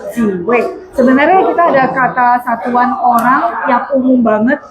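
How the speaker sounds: ordinary talk, nothing marked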